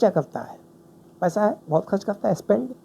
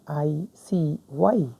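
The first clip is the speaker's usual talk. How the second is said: drawn out answer; much slower than usual. Shown as a demonstration. Teacher drawing out the answer word for students. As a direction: stretch ICY very slowly, syllable by syllable. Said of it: "तो आप क्या करेंगे?".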